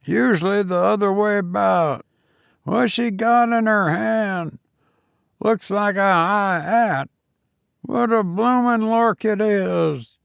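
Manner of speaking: a cockney cabman watching the chase; old cabman Toodles. Acted. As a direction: gossip like an old London loafer.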